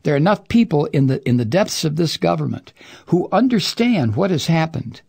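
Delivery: ordinary talk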